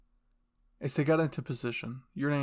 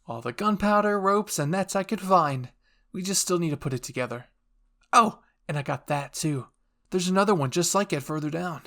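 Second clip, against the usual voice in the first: higher, louder eager listing; excited rambling; 5 semitones higher, 6 dB louder than usual. Acted. Hanji shows Erwin the supplies, high and excited. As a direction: show off the haul like a thrilled kid.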